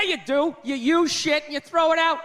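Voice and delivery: normal talking voice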